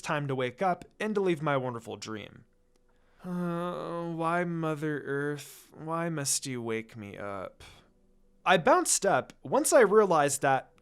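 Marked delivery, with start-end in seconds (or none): 3.2-7.6 slow, drowsy groan of protest; groggy just woken. Acted. Lucy woken by the sun, grumbling sleepily. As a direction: drag it out like you can't open your eyes yet.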